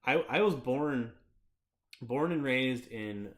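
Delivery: ordinary talk, nothing marked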